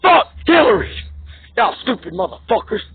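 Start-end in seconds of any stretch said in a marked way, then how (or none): 0.1-0.9 higher-pitched, exploding curse; furious cry of contempt; real angry outburst. Real, not acted. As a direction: burst out with it in anger. Last, exploding repeat of the curse.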